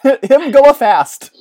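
loud, slow, high mock-primitive voice; hammed-up dim brute. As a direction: boom it out slowly in broken English. Playful caveman echo of "go fast.

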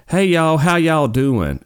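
high, loud mock Mississippi twang; imitated Southern accent. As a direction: mimic an exaggerated Southern greeting, drawn out. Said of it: "I thought she was making her accent up".

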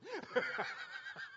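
high, loud burst of laughter. Real, not acted. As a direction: laugh out at your own joke. Laughs at own joke.

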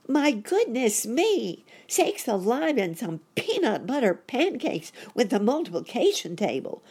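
put-on high, loud child exclamation; alarmed boy cry. A narrator's reading. Boy rabbit startled by popping, high loud cry. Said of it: "cried Sammy".

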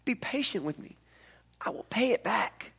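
whispered, desperate plea; begging whisper. Acted. Fellow servant begging on his knees, whispered plea. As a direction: plead softly on your knees. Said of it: "fell on his knees and begged him".

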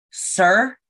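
put-on demanding the boy explain himself. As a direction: call him out sharply. Confronting the sleepwalking boy.

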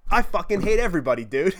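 ordinary delivery

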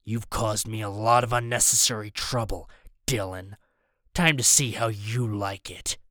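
villain's low menace; menacing villain. A narrator's reading. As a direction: keep the threat low and controlled. Villain threatens boy over radio.

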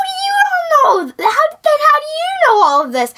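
high, loud incredulous cry; exasperated shout. Retold or imitated. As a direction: yell it in disbelief. Finn's exasperated retold outburst.

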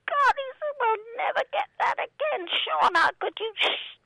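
high, exasperated outcry; furious director. Retold or imitated. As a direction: wail at the actor in despair. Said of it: "The director was furious".

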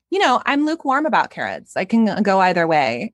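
put-on high-pitched Valley Girl accent. Giving an opinion on carrots, Valley Girl accent. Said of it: "play the Valley Girl? 'Cause you do that so well".

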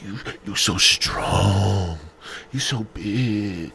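slow, drawn-out mock adoration; hammed-up fawning; ego-stroking flattery. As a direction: coo the compliments, stretching each one. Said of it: "You gotta stroke his ego".

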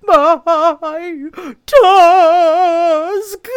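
hammed-up high, loud blubbering; wailing. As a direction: wail it out into boo-hoo sobs. Rhinoceros breaks into weeping.

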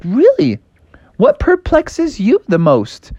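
high, slow curious frog. Acted. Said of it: "And Froggy's like".

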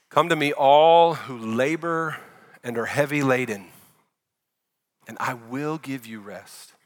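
slow, fading to a hush on give you rest; tender reading. Acted. Pastor reading Jesus' invitation, slow and fading.

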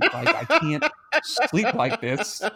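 high, slow laughing plea; breaking into laughter while complaining; laughing complaint. Retold or imitated. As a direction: laugh through the words, pitch up. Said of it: "I'm like".

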